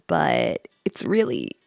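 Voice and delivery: normal talking voice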